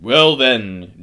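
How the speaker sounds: loud, projected lead-in; commanding call. A narrator's reading. Aslan replies up to the giant, loud command.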